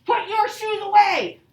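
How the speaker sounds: high, loud, slow "Put your shoes away"; forceful command. Shown as a demonstration. Teacher shows the class a command said with strong feeling, loud. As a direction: bark it like a fed-up parent. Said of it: "if I say it like this".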